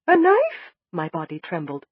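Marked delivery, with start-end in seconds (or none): 0.1-0.7 much higher and louder, startled; alarmed high question. Acted. Learning Doc was threatened with a knife, alarmed. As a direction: recoil at the word knife.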